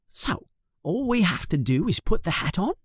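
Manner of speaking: put-on a nervous kid checking it's that simple; asking his friend, hopeful; Ron. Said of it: "Ron says to Harry".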